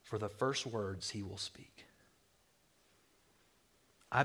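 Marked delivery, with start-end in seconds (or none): 0.0-1.9 whispered suspense. Real, not acted. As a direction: breathe it as a secret. Hushed suspense in the synagogue.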